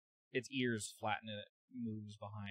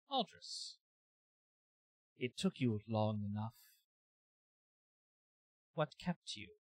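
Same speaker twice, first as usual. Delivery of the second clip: a hint of an alien entity greeting an old friend; eerie creature voice; 4 dB louder, slower than usual. Creature greets the player character Aldris, lightly otherworldly. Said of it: "And it says".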